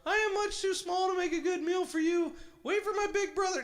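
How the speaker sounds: very high, loud pleading little goat; squeaky goat begging the troll; tiny goat voice. A narrator's reading. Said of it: "said the littlest billy goat gruff".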